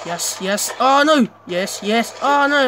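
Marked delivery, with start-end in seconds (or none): 0.8-1.3 loud, high burst of dismay; panicked cry. Real, not acted. Player mid-firefight, panicked cry. 2.2-2.7 loud, high burst of dismay; panicked cry. Real, not acted. Player mid-firefight, panicked cry.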